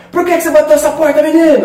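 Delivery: high, fast scolding mother; scolding mom. Retold or imitated. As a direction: snap at a kid like an angry mom. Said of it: "sua mãe fala".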